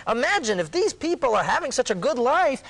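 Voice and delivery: high, animated persuasion; excited reasoning. Retold or imitated. Akiva argues from the Romans' good life, excited reasoning. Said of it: "he says".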